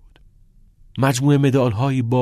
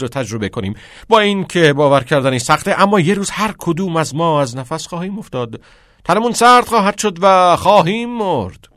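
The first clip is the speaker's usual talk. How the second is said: loud, high, impassioned teacher's cry; raised, vehement classroom speech; shouting; 8 semitones higher, 8 dB louder than usual. Acted. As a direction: cry out and lecture the boys urgently. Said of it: "کتینگ فریاد زد".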